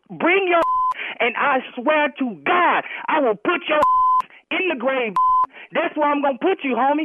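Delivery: slow, deliberate, spaced-out warning; slow threat. Real, not acted. Husband warns caller off his door, slow and deliberate. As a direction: spell the threat out slowly. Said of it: "I will say it slow to you".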